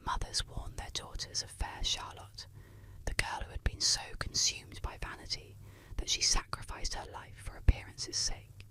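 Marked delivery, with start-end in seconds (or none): none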